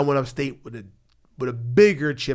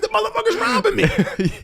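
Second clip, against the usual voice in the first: outraged retort; 5 dB louder than usual. Retold or imitated. Answering the security officers.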